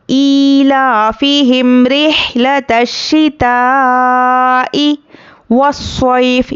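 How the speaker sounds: slow chanted tilawat; Quran recitation. Shown as a demonstration. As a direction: recite slowly with long vowels. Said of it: "teacher recites". (धीमी तिलावत)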